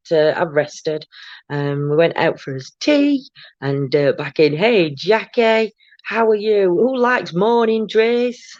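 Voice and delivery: normal talking voice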